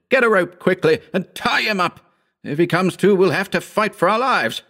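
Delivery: high, urgent orders; commanding. A narrator's reading. Wounded Howard sending his friend for a rope, commanding. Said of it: "he commanded".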